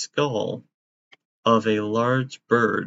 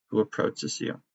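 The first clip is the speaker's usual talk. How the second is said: normal talking voice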